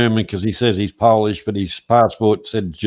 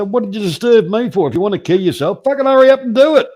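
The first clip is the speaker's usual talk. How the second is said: mock callous rant; 10 semitones higher, 4 dB louder, faster than usual. [name] imagining answering a Lifeline caller, mock shouting high and fast. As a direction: pitch up high, climbing into a louder, faster mock shout. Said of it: "I'd say".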